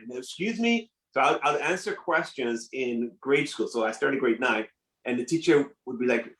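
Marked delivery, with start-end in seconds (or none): 0.2-0.9 high-pitched, quizzical imitation; mock puzzled. Classmates failing to understand the accent, mock puzzled. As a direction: act out a baffled listener, pitched right up.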